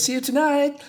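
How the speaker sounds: high, airy acted goodbye; lilting high-pitched send-off; sing-song farewell. Robot bids household goodbye.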